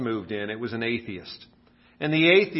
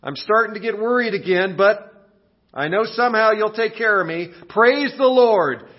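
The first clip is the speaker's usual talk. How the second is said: higher, louder, hopeful prayer; widow praying; 6 semitones higher, 4 dB louder than usual. Acted. Widow praying to God with no food left, hopeful. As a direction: pray up, worried yet trusting. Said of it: "lifts her arms and says".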